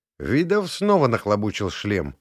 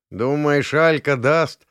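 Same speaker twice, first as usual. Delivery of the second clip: doubtful; 5 semitones higher, 5 dB louder, slower than usual. A narrator's reading. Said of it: "с сомнением сказал Олег".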